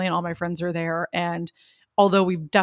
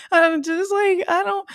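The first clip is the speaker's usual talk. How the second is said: high, teary whimpering, voice cracking into a cry; mock crying whine; 11 semitones higher, 8 dB louder, much slower than usual. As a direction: play the helpless weepy girl who can't explain. Mimics tearful confused rambling.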